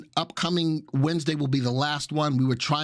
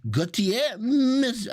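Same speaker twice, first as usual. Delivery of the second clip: mock French accent; 9 semitones higher, much slower than usual. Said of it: "My French accent is impeccable".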